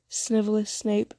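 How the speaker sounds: ordinary delivery, nothing marked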